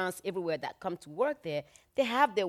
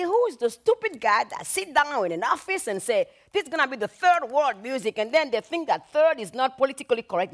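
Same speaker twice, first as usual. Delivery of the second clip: loud, higher outraged rant; retold anger; 6 semitones higher, 8 dB louder than usual. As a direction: rant like a furious singer. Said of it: "she was mad as hell".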